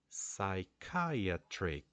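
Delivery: "psychiatric" very slowly, light psy, strong CHI; stress on second syllable. Shown as a demonstration. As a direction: draw out "psychiatric", leaning on CHI. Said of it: "followed by a strong syllable".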